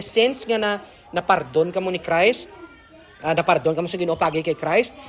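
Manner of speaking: normal talking voice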